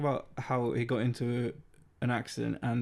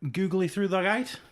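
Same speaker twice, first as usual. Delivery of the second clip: higher, incredulous questioning read; baffled quote; 8 semitones higher, 4 dB louder than usual. Acted. Reading impossible cricket terminology, baffled quote. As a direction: quote it loudly as if it's nonsense.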